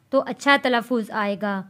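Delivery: normal talking voice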